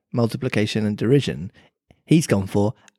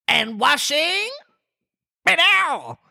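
high, slow question and a meow; Mock Turtle asking about washing in a high voice; much slower than usual. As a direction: ask it like a crooning cat. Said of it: "said the Mock Turtle".

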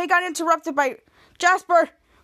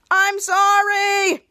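long, drawn-out raised shout; called-out apology; 4 semitones higher, 5 dB louder, much slower than usual. Retold or imitated. Boy fleeing with the phone, stretched shouted apology. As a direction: sing out the apology slowly and louder. Jasper calls back while fleeing.

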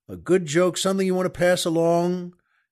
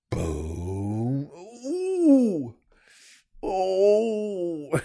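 mock reaction noises; 3 semitones higher, much slower than usual. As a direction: playfully wail at the punchline. Reacting to his own punchline.

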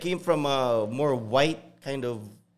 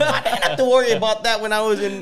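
higher and louder, laughing disbelief; mock bewilderment; 9 semitones higher, 7 dB louder than usual. Remembering LA gang rules, loud laughing disbelief. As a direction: replay his shocked teen self, half-laughing. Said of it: "I'm like".

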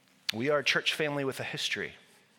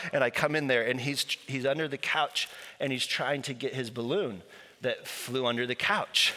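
normal talking voice

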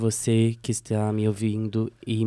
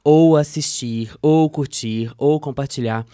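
announcer voice; 3 semitones higher, 6 dB louder than usual. Acted. Hosts opens the podcast.

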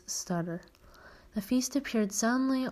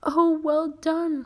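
much higher and louder, slow cry of praise; delighted cry; 9 semitones higher, 7 dB louder, slower than usual. A narrator's reading. Teacher praising a pupil's spell, high delighted cry. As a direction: burst out with a high delighted cheer. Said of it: "cried Professor Flitwick".

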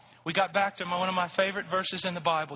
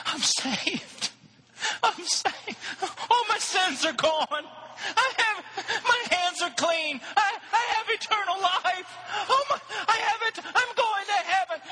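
overjoyed cry; 14 semitones higher, slower than usual. Retold or imitated. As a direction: voice breaking high with joy, laughing.

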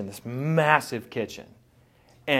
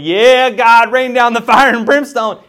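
loud, high cry for judgment; impatient plea; 8 semitones higher, 13 dB louder than usual. Retold or imitated. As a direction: call down fire from heaven. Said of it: "Asaph's sitting back going".

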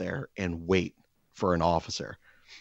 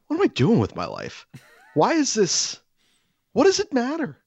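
rising much higher in disbelief; exasperated self-talk; 4 dB louder than usual. Retold or imitated. Asking himself why he's chasing a jug, voice climbing high. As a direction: climb into a squeaky exasperated whine.

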